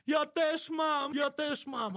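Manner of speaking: high, very slow cry of a shaking grandmother; trembling old woman. Retold or imitated. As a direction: hold out the words like a trembling old lady. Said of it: "trzęsąc się i krzyczy".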